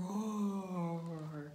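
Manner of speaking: long, rolling storybook roar; drawn-out roar. A narrator's reading. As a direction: stretch the word out into a roar. Narrator imitates Blue Blue's roar.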